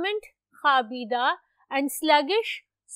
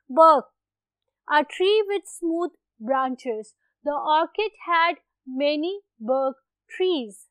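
slow, clear dictation for note-taking; teacher dictating an example sentence slowly; slower than usual. Shown as a demonstration. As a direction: let each word land slowly so they can write. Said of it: "बड़े आराम आराम से इसलिए बोलती हूँ".

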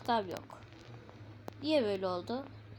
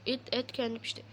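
ordinary talk

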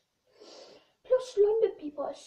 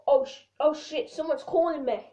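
ordinary talk, nothing marked

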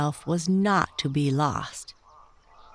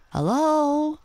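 frog calling higher for the grub; 12 semitones higher, 3 dB louder than usual. A narrator's reading. Frog calling for the vanished grub, higher. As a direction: call out to the missing friend.

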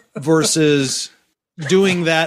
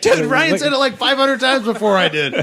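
laughing; 10 semitones higher than usual. Real, not acted. Laughing defense of himself.

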